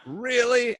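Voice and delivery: high surprised question; a screener suddenly believing it; amazed really. Retold or imitated.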